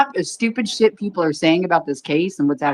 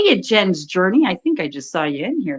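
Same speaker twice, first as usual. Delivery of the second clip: usual speaking voice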